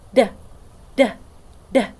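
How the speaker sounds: short voiced "Duh" taps, tongue at the teeth; letter D sound. Shown as a demonstration. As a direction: tap out "Duh" with voice on. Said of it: "Let's do it together".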